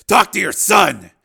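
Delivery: loud, high, slow command; barked order. Acted. Steele holds radio out to captive, barked order. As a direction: shout it as an order to a prisoner.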